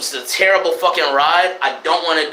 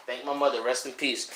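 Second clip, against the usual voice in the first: much softer, reverent aside; hushed; 7 semitones lower, 12 dB quieter than usual. Real, not acted. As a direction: drop to a near hush. Remembering late mother.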